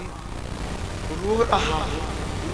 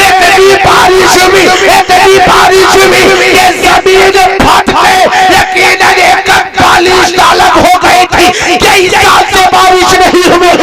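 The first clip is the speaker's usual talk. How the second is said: loud, slightly higher fervent sermon; full-force preaching; 5 semitones higher, 13 dB louder than usual. Acted. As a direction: proclaim the miracle to the crowd. (पूरे जोश से ऊँची आवाज़ में तक़रीर)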